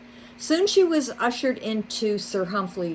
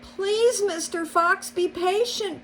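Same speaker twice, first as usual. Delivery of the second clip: much higher, pleading cry; begging; 13 semitones higher than usual. A narrator's reading. Mole begging the fox on his knees, much higher. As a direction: beg on your knees. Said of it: "Barnabas begged".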